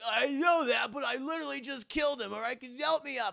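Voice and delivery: high, strained, whiny protest; exasperated pleading. Retold or imitated. Dangling hero protesting to his teasing friend, high exasperated plea. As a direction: argue back in a squeezed high voice.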